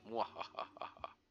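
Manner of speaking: slow breathy evil laugh; sneering panto villain. Pretend. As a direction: cackle like a cartoon baddie. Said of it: "Me veo muy poderoso con esta armadura".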